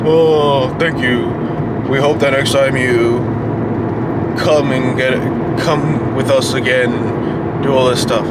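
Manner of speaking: low, subdued customer-service voice; imitated car dealer. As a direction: mimic a salesman's routine polite send-off. Voices the dealer's polite send-off.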